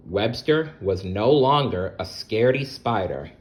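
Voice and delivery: usual speaking voice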